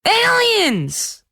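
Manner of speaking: high panicked cry; terrified scream. Acted. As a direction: shriek it in fright. Boy screaming in terror.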